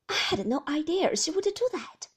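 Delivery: an embarrassed sister-in-law apologising; apologetic Beatrice. Acted.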